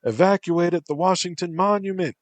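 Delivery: a high, loud military order. A narrator's reading. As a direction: order the evacuation. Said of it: "Captain Jackson ordered".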